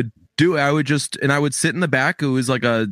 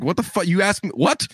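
retold indignation; 6 semitones higher than usual. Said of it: "I'm like".

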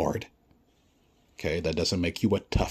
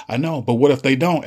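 smug official; 7 semitones higher, 9 dB louder than usual. Retold or imitated. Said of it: "And then someone says".

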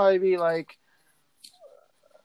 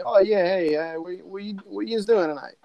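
lightly put-on Pittsburgh talk, pitched up; joking Pittsburgh accent; 4 semitones higher than usual. Joking with rival peers about evening plans, mock Pittsburgh accent. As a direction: play at a local Pittsburgh greeting. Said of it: "we'll kinda joke and say".

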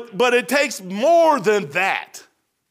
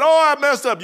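higher, louder acted admission; mock confession; 7 semitones higher, 5 dB louder than usual. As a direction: blurt it out like a penitent's quick confession. Acts out a sinner confessing.

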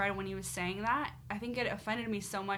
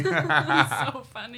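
laughing; 4 semitones lower, 11 dB louder, much slower than usual. Real, not acted. Laughing at the story.

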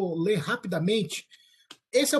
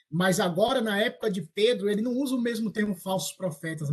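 normal talking voice

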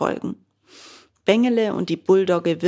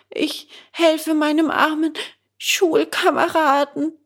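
put-on high, anxious boy's voice; a scared boy explaining himself; frightened puppet boy; 9 semitones higher, 4 dB louder than usual. Bengele answers the gendarmes in a high, anxious voice.

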